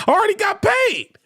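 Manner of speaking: high-pitched defiant cry; defiant outburst. Acted. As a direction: shout it up high, triumphantly brushing them off. Mock heckler retort, repeated for effect.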